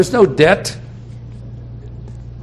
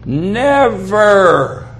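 Never drawn out, higher and punched; stress on Never; 5 semitones higher, 3 dB louder than usual. Shown as a demonstration. Preacher emphasizing first word of verse, stress on Never. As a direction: hit Never hard and hold it. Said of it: "I'm going to emphasize that first word".

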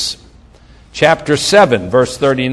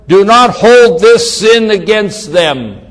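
raised, higher voice, slow and emphatic; crying out loudly; 5 semitones higher, 5 dB louder, slower than usual. A narrator's reading. Preacher reads Stephen's dying prayer aloud, crying out. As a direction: cry it out slowly in a loud voice. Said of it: "he cried out with a loud voice".

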